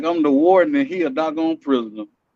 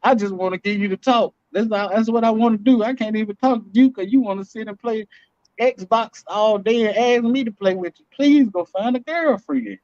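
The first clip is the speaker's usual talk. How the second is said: pleading dad; 6 semitones higher than usual. Acted. Acting out begging his son.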